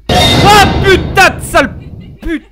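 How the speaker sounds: terrified screaming curse; loud high shriek; panicking gamer. Real, not acted. Jump scare screaming insult.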